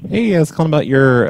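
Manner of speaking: normal talking voice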